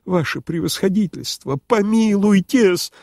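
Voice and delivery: high, imploring protest; pleading. A narrator's reading. Host soothing his humiliated superior, pleading. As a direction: plead upward, stretching the last word.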